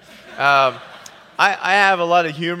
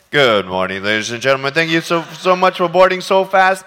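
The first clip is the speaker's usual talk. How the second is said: captain announcement; 8 dB louder than usual. Retold or imitated. Nick on the plane microphone, captain announcement. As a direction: open like a pilot on the intercom. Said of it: "and I said".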